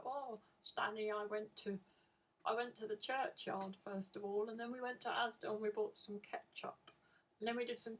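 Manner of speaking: a son chatting sweetly to his dog; Connor's Stan voice. Retold or imitated. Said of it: "in this high-pitched voice".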